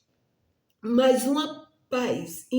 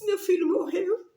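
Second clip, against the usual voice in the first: crying; 6 semitones higher than usual. Retold or imitated. Mother thinking son died.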